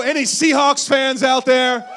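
loud slow shout to the room; rally the crowd; calling out. Real, not acted. Pastor shouts out to Seahawks fans, loud rally call.

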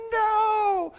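put-on high little girl's wail "No"; a child crying out "No" in protest; daughter's whine. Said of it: "she was like".

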